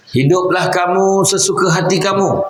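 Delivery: Jibril's warning lifted high and drawn out. Acted. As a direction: raise the pitch and slow down on the quoted advice. Quoting Jibril, then restating plainly.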